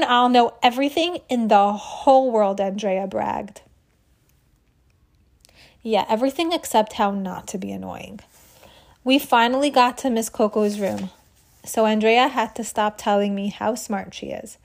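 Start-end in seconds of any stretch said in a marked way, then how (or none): none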